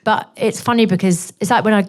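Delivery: normal talking voice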